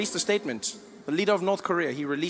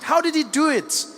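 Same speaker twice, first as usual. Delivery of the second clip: shocked; 8 semitones higher than usual. Retold or imitated. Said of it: "People are shocked".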